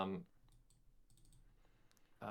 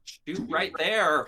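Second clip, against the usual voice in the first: calling out; 8 semitones higher, 13 dB louder, slower than usual. Acted. Character urges ally to shoot.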